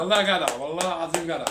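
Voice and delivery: loud, high excited cheering; excited praise. Real, not acted. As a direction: cheer him on loudly.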